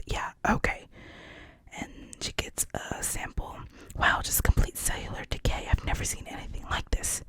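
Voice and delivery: hushed, unvoiced storytelling; whispered movie recap; whispered retelling. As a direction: tell it all in a whisper.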